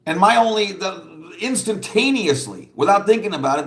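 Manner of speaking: usual speaking voice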